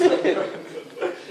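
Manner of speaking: loud, high laugh; amused cackle at own impression. Real, not acted. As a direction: burst out laughing. Laughs at own creepy imitation.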